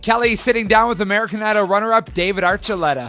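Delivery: upbeat radio host intro; radio announcer. Acted.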